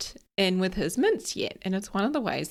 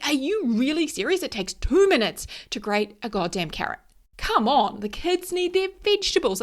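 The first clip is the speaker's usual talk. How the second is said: much higher and louder, rising to an exasperated yell; retold angry outburst; 8 semitones higher, 5 dB louder than usual. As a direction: replay the furious nagging at the partner, building to a shout. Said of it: "I was so angry".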